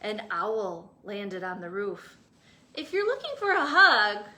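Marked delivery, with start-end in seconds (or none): 2.7-4.3 higher, louder, fast; hopeful. Acted. Cactus offering an owl a hug. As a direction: offer shyly but eager. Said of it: "said Hank".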